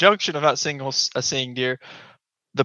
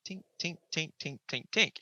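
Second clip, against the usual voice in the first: very soft, breathy tink tink; imitated antler clicks; 2 semitones higher, 14 dB quieter than usual. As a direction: tap out the click of antlers on wood under your breath. Imitating antlers hitting dry wood.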